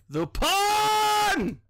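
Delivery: high, loud, drawn-out holler; long ringing shout; loud call. Real, not acted. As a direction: belt out the name and hold it high. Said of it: "was that loud?".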